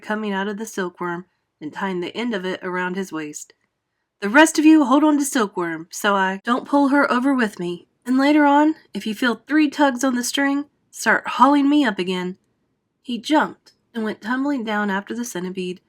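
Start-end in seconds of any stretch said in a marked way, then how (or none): 4.2-12.4 urgent instructions. Acted. James giving orders.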